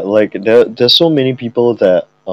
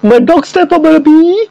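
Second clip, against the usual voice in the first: very high squeaky meme voice; meme voice shriek; 4 dB louder than usual. Pretend. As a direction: shriek it like the viral clip.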